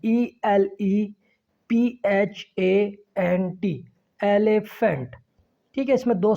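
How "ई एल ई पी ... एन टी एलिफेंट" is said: Elephant spelt slowly letter by letter, then said whole; spelled out. Shown as a demonstration. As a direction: spell elephant in separated letters before saying it. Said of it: "तो ये ज़रा multi syllable है". (Elephant के हिज्जे, एक-एक अक्षर अलग)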